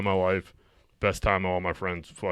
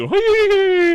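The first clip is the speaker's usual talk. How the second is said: high, loud whinny; mock horse neigh; 9 dB louder than usual. Co-host teasing about the horse ride, loud mock neigh. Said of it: "you didn't have to neigh".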